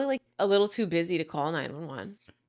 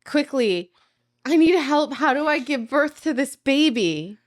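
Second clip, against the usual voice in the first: mock panic; 5 semitones higher, 6 dB louder than usual. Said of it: "No, sell it".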